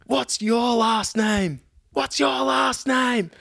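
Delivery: high, drawn-out mock shout; retold yelling. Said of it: "she's in the aisle yelling at this hostie".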